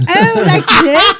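hammed-up British accent, high and slow with a laugh; high slow mock British accent with a laugh. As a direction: put on a jokey British accent. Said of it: "speaking with a British accent if they're nervous".

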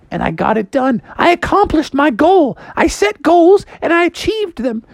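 high-pitched mom voice; high mock-motherly pep talk; Froggy's boastful mom. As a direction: tell your triumph in a high, emphatic voice.

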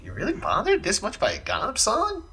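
retold baffled question; incredulous. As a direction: ask it like you can't believe they care. Retells own disbelief at band's reaction.

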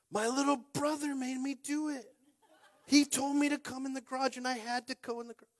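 put-on high, laughing kid voice; imitating his son. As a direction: play the boy blaming his brother. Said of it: "You know what he said".

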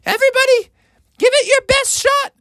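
rally everyone up high; mock scream. Mother Bear shouts to the pushing family, high and rallying. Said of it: "she screamed out".

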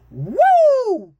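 loud high hype yell; excited whoop. Real, not acted. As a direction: whoop it up like a fan. Hyping up the sports show.

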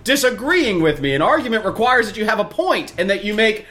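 exasperated lecturing. Retold or imitated. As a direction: lecture him, fed up and high. Acted exasperated explanation.

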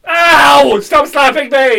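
loud, high yelp of protest; pained shout. Acted. Slapped patient tells attacker to stop, pained shout.

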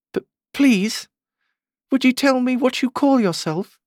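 high, stammered polite request; timid. A narrator's reading. Alice asking the fawn its name, timidly. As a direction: ask hesitantly in a small high voice. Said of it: "she said timidly".

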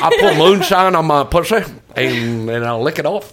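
put-on loud, laughing hillbilly southern accent; mock southern accent. Host kids his friend about her accent, mock southern. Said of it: "with my southern accent".

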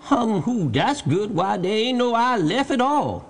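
high-pitched dialect voice, amused wonder; Jupiter, baffled servant. A narrator's reading. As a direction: puzzle aloud from the tree. Jupiter looks for the eye.

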